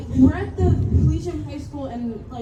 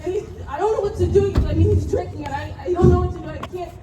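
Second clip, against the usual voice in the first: loud, frantic and higher, voice cracking with panic; panicked friend; 7 semitones higher, 4 dB louder than usual. Acted. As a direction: yell for help over a friend who won't wake. Staged crash, friend panics.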